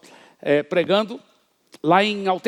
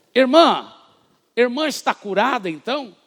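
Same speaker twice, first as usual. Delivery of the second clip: calling out; 6 semitones higher, 6 dB louder than usual. Retold or imitated. Pastor calls out to woman.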